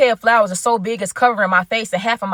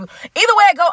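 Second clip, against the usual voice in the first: high, loud burst of excitement; super excited; 9 semitones higher, 6 dB louder than usual. Retold or imitated. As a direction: blurt it out, thrilled. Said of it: "I'm super excited".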